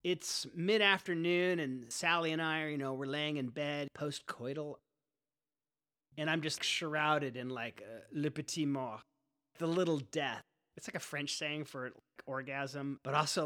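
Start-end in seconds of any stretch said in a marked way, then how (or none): none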